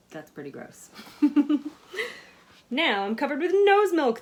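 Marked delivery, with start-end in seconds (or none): 0.9-2.3 loud laugh. Real, not acted. Laughing at milk joke. 2.7-4.2 much louder and higher, disgusted; loud dismayed cry. A narrator's reading. School's dismayed thought.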